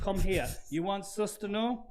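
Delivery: mock foreign seller. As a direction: beckon a customer with a dodgy offer. Voices foreign seller calling customer over.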